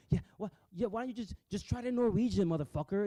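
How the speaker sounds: high, loud sarcastic taunt at the speaker; mocking sarcastic jab. Vlogger mocking the conference speaker, sarcastic high taunt. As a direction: throw it back at him like a sneering heckle. Mocking the clip's language mix-up.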